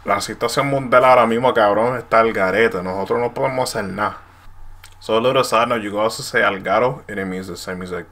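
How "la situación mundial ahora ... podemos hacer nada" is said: loud, exasperated Puerto Rican Spanish; complaining in Puerto Rican slang; Puerto Rican accent. Shown as a demonstration. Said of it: "For example".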